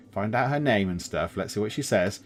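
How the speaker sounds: ordinary talk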